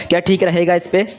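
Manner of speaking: ordinary delivery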